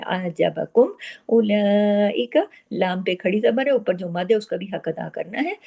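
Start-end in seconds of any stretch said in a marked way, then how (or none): 0.0-2.5 आजबकुम उलाइका slow, ain kept smooth; careful ain. Shown as a demonstration. Teacher showing students the careful ain. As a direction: ease through the ain gently. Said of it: "बहुत एहतियात से पढ़ना है".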